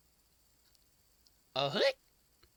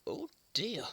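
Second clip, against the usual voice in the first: whispered sympathy; 3 semitones higher than usual. Acted. Whispering sympathy to the hiccuping monkey. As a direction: breathe it out in pity.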